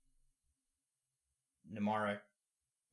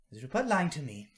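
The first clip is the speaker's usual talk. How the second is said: higher, louder granny calling out to a friend; suspicious granny; 7 semitones higher, 10 dB louder than usual. Acted. Granny asking Nula if the boy lies, higher and louder. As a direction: call over, then ask pointedly.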